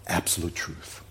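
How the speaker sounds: quiet, low and weighty; hushed solemnity. Real, not acted. Preacher stating what Jesus spoke, hushed and solemn. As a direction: let it drop to a grave hush. Solemn statement before the sceptic's echo.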